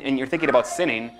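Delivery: ordinary delivery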